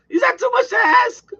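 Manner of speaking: high, loud exasperated question; exasperated plea. Real, not acted. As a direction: voice leaps up in exasperation. Exasperated rant about bad advertising.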